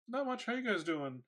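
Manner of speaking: high, breezy fake innocence; mock innocent casual. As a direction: play it cool like nothing happened. Hiding shaved eyebrows from parents.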